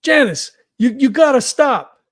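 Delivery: slow, loud appeal to someone out of control; yelling out. Acted. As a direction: plead at full voice, spacing the words out. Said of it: "Harry just yells out".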